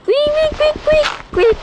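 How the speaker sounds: high, rapid frantic repeats; running to hide, frantic high urging. Real, not acted. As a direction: hurry everyone in a panic. Urging escape from danger.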